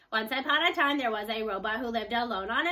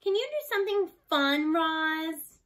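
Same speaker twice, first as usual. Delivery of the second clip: eager plea; 5 semitones higher, 5 dB louder, slower than usual. A narrator's reading. Girl pleading with robot.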